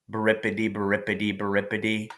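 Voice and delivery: plain reading voice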